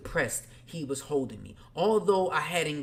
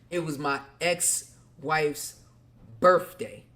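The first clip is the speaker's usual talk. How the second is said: dramatic reveal of birthday; punchline reveal; slower than usual. Real, not acted. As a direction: hold back, then hit birthday hard.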